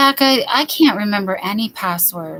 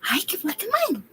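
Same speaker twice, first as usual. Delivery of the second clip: dog talk; 10 semitones higher, much faster than usual. Real, not acted. Owner cooing to her dog in a high voice. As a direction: coo at the dog, sweet and high.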